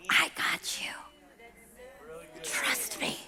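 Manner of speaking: put-on soft breathy whisper, God to his child; God reassuring the frustrated believer in a whisper; whispered reassurance. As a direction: lean in and whisper comfort.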